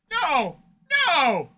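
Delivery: loud, high cry of protest; hammed-up despairing shout; mock outraged shout. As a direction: yell no in playful horror. Reacting to being blacklisted.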